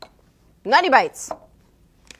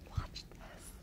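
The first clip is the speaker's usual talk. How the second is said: whispering; 22 dB quieter than usual. Real, not acted. About to dip pretzel in dip.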